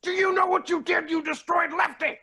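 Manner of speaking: high indignant shouting; outraged yell. Acted. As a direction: rage at the moose. Yelling at moose over injury.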